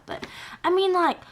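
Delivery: usual speaking voice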